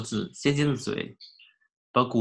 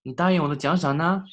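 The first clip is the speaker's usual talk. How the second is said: high, louder worried question; anxious call; 6 semitones higher, 4 dB louder, faster than usual. A narrator's reading. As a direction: press anxiously for the reward. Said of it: "鹤焦急道".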